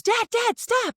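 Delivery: put-on high, loud kid shout; a child shouting at dad to stop; frantic little kid yell. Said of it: "Harry goes".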